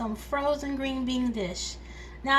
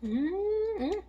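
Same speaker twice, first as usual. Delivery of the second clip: high, pleased mm-mm of delight; savoring hum; 9 semitones higher than usual. Real, not acted. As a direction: hum like the first bite is great. Tasting food on camera.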